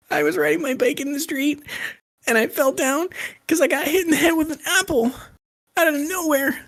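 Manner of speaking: put-on high kid voice telling what happened; little kid voice. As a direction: pitch way up like a small crying kid. Child voiced in joke.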